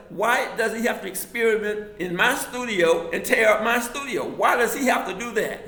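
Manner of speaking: loud, high exasperated demand; indignant outburst. Retold or imitated. To the father about the wrecked studio, loud indignant demand. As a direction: demand an answer, fed up.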